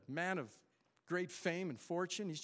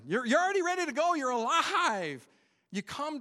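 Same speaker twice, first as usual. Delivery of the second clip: much higher, louder, fast burst then stretched you're alive; excited; 9 semitones higher, 7 dB louder than usual. Acted. Pastor telling church they're already alive, excited burst. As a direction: burst out with joyful energy.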